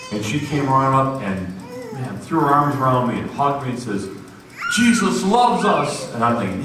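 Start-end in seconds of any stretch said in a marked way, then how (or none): 4.8-6.1 high, drawn-out joyful cry, imitated; tearful joy. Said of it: "she's crying".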